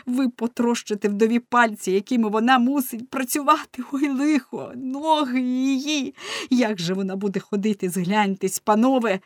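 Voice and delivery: high, loud anguished plea; crying out again. A narrator's reading. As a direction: beg in anguish at full voice. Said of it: "він скрикнув знову". (високе, гучне відчайдушне благання)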